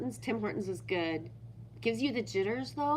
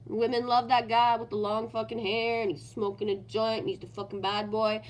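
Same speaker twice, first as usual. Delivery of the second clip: usual speaking voice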